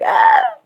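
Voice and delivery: very high, loud squeal; middle-schooler squeal. Acted. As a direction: let out a giddy schoolkid shriek. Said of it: "act like a fucking middle schooler".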